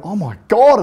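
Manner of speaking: acting out a horrified realisation; mock-horrified outburst; retold shock. Retold shock on hitting pedestrian.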